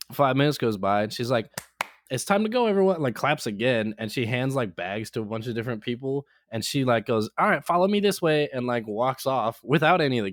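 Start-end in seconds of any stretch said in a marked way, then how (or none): 2.1-3.0 high, bossy call to the group; imitating a mom herding everyone out; sing-song high group announcement; mock announcement. 7.4-8.5 a hint of a tour-guide mom, higher; lightly imitated bossy leader; mildly raised call to the group; mock announcement.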